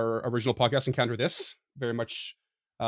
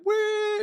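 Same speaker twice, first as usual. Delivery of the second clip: high drawn-out whistle noise; imitated whistle; 3 dB louder than usual. Musician offering a whistle part, imitating its high, long note. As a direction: stretch out a high-pitched "wheee" like a whistle.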